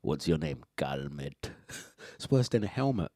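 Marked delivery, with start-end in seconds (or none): 0.0-1.5 quiet mock dialogue. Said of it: "are you saying his name".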